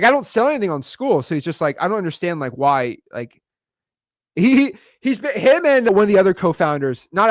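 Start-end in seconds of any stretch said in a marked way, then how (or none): 0.0-1.2 retry of the accent, higher and voiced unlike himself; second, fuller go at his accent, pitched up; Sam Ovens accent. Retrying the Skool CEO's line with a higher, put-on accent. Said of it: "I can't say his accent right".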